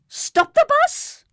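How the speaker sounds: very high, loud, slow panicked cry; old man crying out for the bus to stop. A narrator's reading. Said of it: "Mister Weasley cried".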